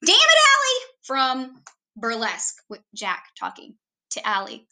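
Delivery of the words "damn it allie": exasperated movie-quote shout. Retold or imitated. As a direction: quote it as a loud, high-pitched holler. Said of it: "I always have to say it like that".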